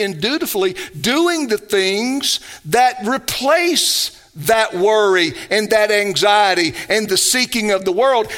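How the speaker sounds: deliberate, weighty pulpit cadence; emphatic preaching. Real, not acted. Pastor urging believers to seek God's kingdom first, emphatic preaching. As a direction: press each phrase home to the congregation.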